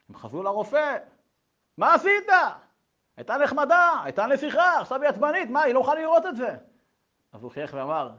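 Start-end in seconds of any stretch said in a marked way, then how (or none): 1.8-6.6 loud, high protest; indignant complaint. Retold or imitated. Parents confront the doctor over their upset girl, indignant. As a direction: demand an explanation from the doctor.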